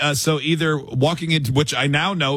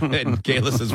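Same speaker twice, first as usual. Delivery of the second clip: ordinary talk, nothing marked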